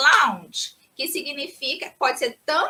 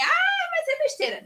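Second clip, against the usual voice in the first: dismissive voice. Pretend. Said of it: "Não é besteira".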